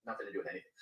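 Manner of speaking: usual speaking voice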